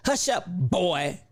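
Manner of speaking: put-on slow, with "boy" drawn out and stressed; emphasis on boy. Showing viewers how he stressed boy, slow. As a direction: lean hard on "boy" as he did. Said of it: "The whole emphasis".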